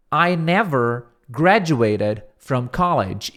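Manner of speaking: each word slow and clear; slow teaching read; slow model read. Shown as a demonstration. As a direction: read it slowly for students. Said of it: "Então, truth be told".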